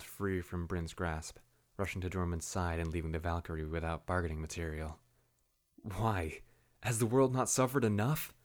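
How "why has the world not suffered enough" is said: louder, higher angry demand; a higher, louder furious demand. A narrator's reading. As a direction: throw the question at them. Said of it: "Bryn demanded".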